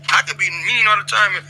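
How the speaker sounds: ordinary talk, nothing marked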